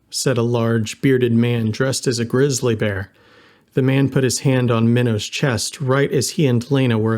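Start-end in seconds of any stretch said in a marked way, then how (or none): none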